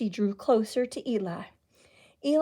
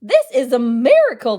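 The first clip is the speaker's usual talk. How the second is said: louder awed exclamation; exclaiming; 10 dB louder than usual. Acted. Soothsayer watching books rain down, loud awed exclamation. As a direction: proclaim it in wonder. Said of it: "the town soothsayer exclaimed".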